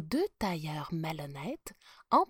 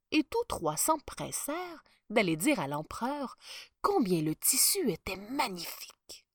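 plain reading voice

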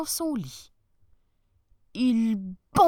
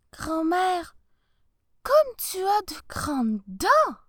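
put-on little girl voice; 9 semitones higher, 5 dB louder, slower than usual. Girl frightened by the teeth.

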